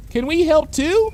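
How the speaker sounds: high, keen little filly voice; eager child. Acted. A schoolfilly eagerly asking to help. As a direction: ask like an eager kid.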